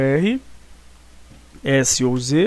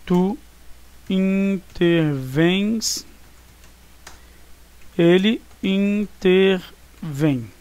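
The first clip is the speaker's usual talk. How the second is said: "intervens" e "intervém" bem devagar, com pausas longas; ditado bem lento; 2 semitones higher, much slower than usual. Shown as a demonstration. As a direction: dita devagar como para os alunos copiarem. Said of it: "Vamos ver a conjugação?". (ritmo de ditado, palavra por palavra)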